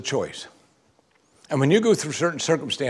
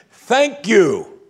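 high, emphatic outpouring of gratitude; slow, exclaimed prayer of thanks; fervent thanks; 7 semitones higher, 6 dB louder than usual. Acted. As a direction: cry out thanks to God. Acted outburst of thanks to God.

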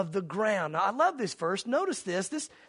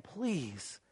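faint cry; 7 semitones lower, 10 dB quieter than usual. Retold or imitated. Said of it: "the echoes of the cries saying".

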